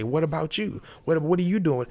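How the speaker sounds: high, indignant mock comeback; defensive teammate. Insulted teammate firing back at critic, high indignant mock voice.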